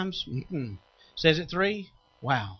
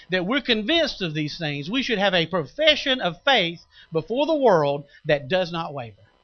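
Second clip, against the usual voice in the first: ordinary talk, nothing marked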